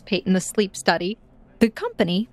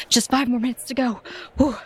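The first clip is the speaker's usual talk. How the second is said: out of breath; 5 semitones higher than usual. Acted. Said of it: "And she huffs out".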